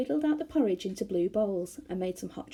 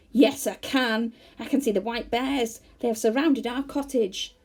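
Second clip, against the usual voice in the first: loud growl; 4 semitones higher, 8 dB louder than usual. A narrator's reading. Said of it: "Then he growled loudly".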